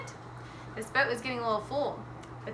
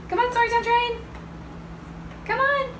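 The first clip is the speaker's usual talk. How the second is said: excited calling out; 13 semitones higher than usual. Pretend. Calling the Storytime train to come, excited call. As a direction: call out brightly to an arriving train.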